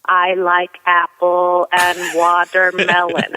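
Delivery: very slow, word by word; rude, condescending. Retold or imitated. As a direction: spell it out slowly as if for an idiot. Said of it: "I said it like you're an idiot".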